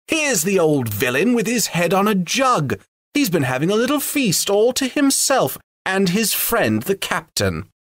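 high, gleeful mocking elf; an elf laughs at the sleeping butler, high and gleeful. A narrator's reading. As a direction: tease the drunk butler. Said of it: "came a cry".

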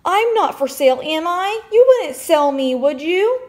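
high, loud tearful pleading; child crying to her dad; bawling. A narrator's reading. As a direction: beg through tears. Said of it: "I'm really bawling".

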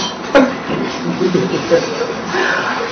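breathy suppressed giggle, nearly silent; stifled laugh. Real, not acted. Speaker mocking the tragedy to his audience, stifled laugh. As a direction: laugh under your breath mid-sentence.

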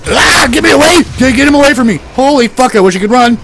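high, loud terrified shouts; yelling at the screen as a demon closes in; panicked yelling. Real, not acted. Player fleeing a monster, screaming in real panic.

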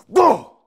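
sudden loud, high bark; one explosive high-pitched woof; imitated dog bark. Skateboarder mimics the dog that startled him, sudden loud bark.

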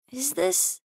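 sigh, then halting question; upset. Acted. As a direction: ask it haltingly after a heavy sigh. Upset, sighs, long pause.

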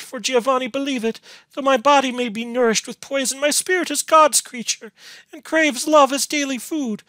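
high, loud desperate protest; anguished, impassioned heroine's outcry; shrieking. A narrator's reading. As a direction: plead frantically, voice held very high. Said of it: "shrieked Beatrice".

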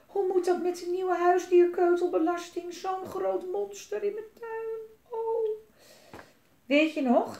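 high-pitched wailing fretful mother; alarmed. A narrator's reading. Mama dreads paying the pet-droppings tax, alarmed. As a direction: fret and wail in a high voice. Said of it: "riep mama verschrikt".

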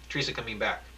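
plain reading voice

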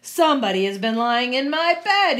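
loud, indignant Papa Bear; crying out. Acted. Papa Bear finding his bed slept in, loud indignant cry. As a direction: project the complaint, pitch climbing. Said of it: "he cried".

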